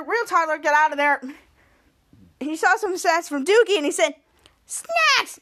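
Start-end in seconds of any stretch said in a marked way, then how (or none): 4.7-5.4 very high squeal of delight; acted excitement. Toddler spots snacks from Doogie, high excited squeal. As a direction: exclaim as if spotting treasure.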